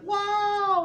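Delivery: high, loud "wow"; awed crowd cheer. Acted. Crowd watching a tightrope walker cross, amazed cheer. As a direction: whoop like an amazed onlooker.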